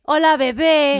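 high, loud, stretched put-on voice; mock sleazy greeting. Mocking how her ex greeted her, high and loud. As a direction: ham up a creepy flirt.

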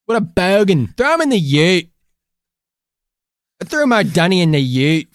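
fading put-on Aussie voice; half-hearted Aussie caricature; mock Australian accent. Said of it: "Nope, lost it".

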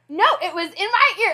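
high, loud retold freak-out; frantic protest. Stung daughter arguing back at mom, high frantic protest. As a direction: push it up into an indignant yell.

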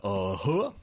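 a lazy Southern uh-huh, lightly mocked; imitating Nick Cage's Southern drawl. Podcaster mocking Nick Cage's bad Southern accent, goofy Southern uh-huh. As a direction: tag a goofy Southern uh-huh onto the end. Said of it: "His accent sounds like".

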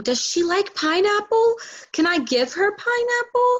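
high, slow questions with rising ends; retold anxious questioning. New parent calling mom from Walmart, puzzled asking. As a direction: stretch it out, climbing to pineapple.

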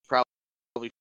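choppy, glitchy call audio; broken up like a lagging connection; robot voice. Real, not acted. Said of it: "[name] is going full robot on us".

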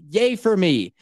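sarcastic mock cheer. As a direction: fake celebrate your own bad luck. Sarcastic self-pity, retold thought.

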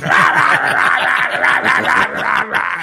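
loud mock war cry, half laughed; imitating Hobie's shouting. Showing the host how Hobie hollered, loud mock yelling. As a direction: rattle off rough yells like a wild old diver. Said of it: "you know, Hobie".

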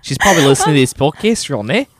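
put-on Australian or New Zealand accent; playful Down Under accent; Korg. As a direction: talk like a chatty Aussie. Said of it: "That was a good accent".

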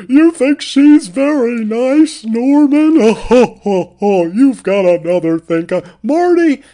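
a stage mother mocking her son; slow, overdramatic laughing mother; Linney as Norma Bates. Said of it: "Laura Linney".

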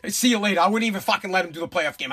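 ordinary talk, nothing marked

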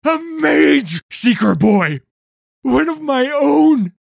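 high, screamed, anguished fury; slow, wounded, full-voice outburst; anger and pain. Acted. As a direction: roar it out in grief and rage. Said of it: "anger and pain seeping from each word".

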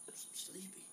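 breathless whispered lie; unvoiced sheepish mumble; whispered excuse. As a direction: whisper it like a caught stoned kid. Stoned kid lying to dad.

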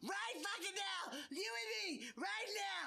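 very high, shouted cartoon rant; Cartman. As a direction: yell like an enraged cartoon kid picking a fight. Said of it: "in the Cartman voice".

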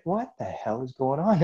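mock bewildered question. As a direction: ask it in disbelief, drawn out. Reliving a bewildering vision.

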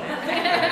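soft unvoiced chuckle at himself; a quick embarrassed laugh; little airy self-conscious laugh; breathy laugh. Real, not acted. Laughs at himself mid-talk.

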